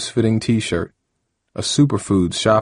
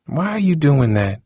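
sad question; 7 semitones higher than usual. A narrator's reading. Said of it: "shaking her head sadly".

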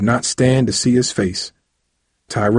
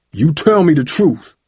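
high, forceful command; angry order; 7 semitones higher, 4 dB louder than usual. A narrator's reading. Father inches from son's face, angry order. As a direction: force the truth out of him.

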